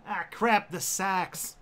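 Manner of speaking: second try at Aussie vowels; put-on Australian, R dropped in "store"; hammy attempt at an Australian accent. Shown as a demonstration. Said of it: "No, that was Boston".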